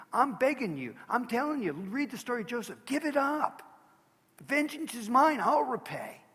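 preacher pleading at full force; impassioned plea. Real, not acted. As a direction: beg the listeners to let go.

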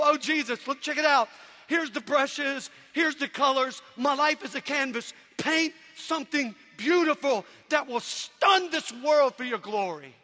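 loud, high pleading prayer; fervent prayer. Pretend. Believer offering his life to Jesus, loud fervent prayer. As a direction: lift it up to Jesus, full voice. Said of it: "and go".

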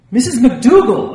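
loud, high shocked cry of her name. A narrator's reading. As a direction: call out her name in alarm. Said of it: "I cried through my fingers".

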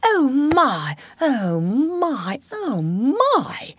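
high, swooping wonder; awed gasp. A narrator's reading. Mole gasps at the beautiful backwater, awed. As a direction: draw out each "my", overcome with wonder. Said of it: "hold up both forepaws and gasp".

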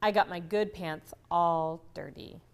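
ordinary talk

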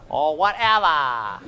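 slow, loud, stretched-out silly voice; put-on comic voice. Teacher joking after the class chant, drawn-out comic voice. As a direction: draw it out loudly for the laugh.

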